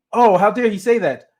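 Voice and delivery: high-pitched imitation of offended listeners; mock outrage. Offended listeners reacting to a sound bite, mock outrage. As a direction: play up the indignation, mockingly.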